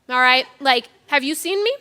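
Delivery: ordinary delivery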